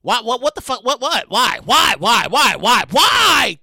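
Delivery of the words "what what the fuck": ordinary talk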